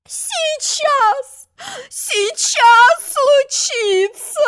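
very high, slow crying wail; hammed-up panicked whimper; starting to wail again. A narrator's reading. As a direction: wind the wailing back up. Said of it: "снова завела королева".

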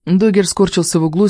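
plain reading voice

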